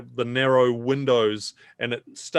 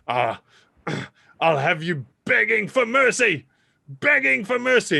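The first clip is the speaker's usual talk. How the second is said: rising higher and louder, menacing; Uncle George threatening; 9 semitones higher, 4 dB louder than usual. Acted. Uncle George vowing revenge on the party, rising in pitch. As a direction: build from a growl to a high threat. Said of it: "Uncle George says".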